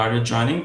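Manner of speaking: "joining" turns upward; yes-no question climbing on "joining"; rising intonation. Shown as a demonstration. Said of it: "ভয়েসটা উপরে যাবে".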